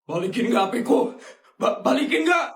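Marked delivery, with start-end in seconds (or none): 1.6-2.5 higher, urgent demand stammered out; insist, getting upset. Acted. Kid demands phone back.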